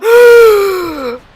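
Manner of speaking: high, loud theatrical gasp; hammed-up gasp of horror; mock shocked gasp; dramatic gasp.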